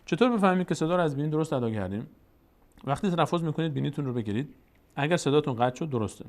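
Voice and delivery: ordinary talk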